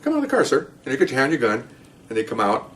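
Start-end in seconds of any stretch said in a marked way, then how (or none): 0.0-0.7 high, lightened voice of a courteous officer; high, unforced officer's request; polite traffic-stop request. Shown as a demonstration. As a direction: coax the driver out, pitched well up. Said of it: "you go like this".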